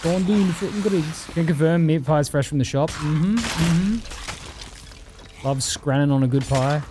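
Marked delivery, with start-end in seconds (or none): 0.0-1.3 lighter mock British lad voice. Streamer repeating the Greggs line in a mock British voice.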